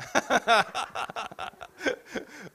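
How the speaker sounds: long, high burst of laughter; real laughter. Real, not acted. Preacher amused by a married man's answer, laughing heartily.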